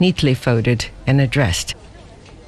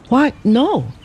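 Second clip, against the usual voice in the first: surprised; 7 semitones higher than usual. A narrator's reading. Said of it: "exclaimed the girl in surprise".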